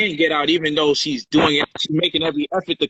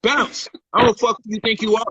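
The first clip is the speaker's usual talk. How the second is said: angry dismissal; 6 semitones higher than usual. Retold or imitated. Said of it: "I'm telling her".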